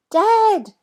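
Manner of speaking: loud, high call; yelling. A narrator's reading. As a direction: shout for dad with sudden relief. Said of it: "Jack yells".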